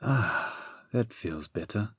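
soft sighing relief; contented sigh. A narrator's reading. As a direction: sigh it out softly, eased. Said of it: "the Sphinx sighed".

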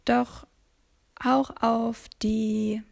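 ordinary delivery, nothing marked

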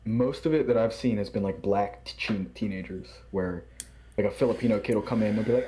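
ordinary talk, nothing marked

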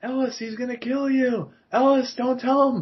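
high, pleading movie line; Bruce Willis quote. Retold or imitated. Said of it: "like Bruce Willis saying".

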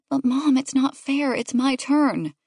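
drawn-out final protest; protesting child. A narrator's reading. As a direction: plead your case to Mom.

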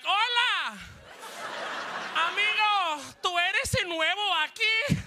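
put-on high-pitched kid greeting in Spanish; singsong, high Spanish kid voice; Spanish-speaking schoolkids. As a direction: imitate an eager schoolkid welcoming the newcomer. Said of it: "all the kids are looking at me like".

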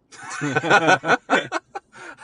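loud high burst of laughter; real laugh. Real, not acted. Host laughing after asking the guest for questions about her sad life. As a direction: laugh out loud at your own joke.

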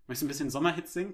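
normal talking voice

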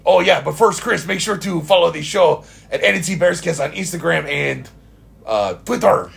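hammed-up French accent; mock French accent. Host telling listeners to follow the show, mock French accent. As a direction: plug the show like a cartoon Frenchman. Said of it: "doing French accents for everybody's voice".

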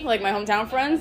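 ordinary talk, nothing marked

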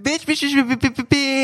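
high, rapid-fire repeated squeal; excited squealing. Real, not acted. Friend reacts to Prince Charming in K3, excited squeal. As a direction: squeal it over and over.

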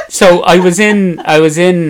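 ordinary talk, nothing marked

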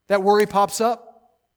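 loud, high pulpit build; full-force preaching. Real, not acted. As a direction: drive each phrase harder, building the worry.

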